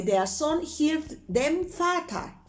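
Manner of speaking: slow, deliberate German sentence; slow German example. Shown as a demonstration. Teacher models German for Spanish speakers, slow and clear. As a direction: say the German slowly for learners. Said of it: "Entonces yo digo".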